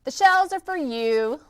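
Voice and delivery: loud, high gloating line; mocking laugh. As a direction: laugh at the cheated elephant. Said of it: "laughed the bug".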